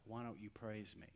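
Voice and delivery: very soft, gentle inner voice; hushed imitation of a tiny voice; still small voice. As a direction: barely voice it, like a quiet nudge from God. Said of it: "a still small voice".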